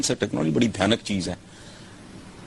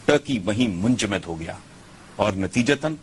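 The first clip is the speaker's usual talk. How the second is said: ordinary talk, nothing marked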